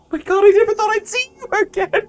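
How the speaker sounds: high, fast, loud relieved mom; overjoyed mother. Acted. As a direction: gush with relief. Mom reunites with child.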